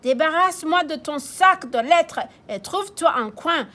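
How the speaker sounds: high loud bossy order; the chief barking his order; police chief ordering. Acted. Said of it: "dit le chef de police". (le chef ordonne fort)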